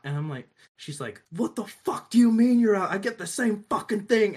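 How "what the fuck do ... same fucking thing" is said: loud, high mock rant of a rude customer; angry customer yell. Retelling the cafe customer yelling at her, mock angry yell. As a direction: play the furious customer, higher and louder.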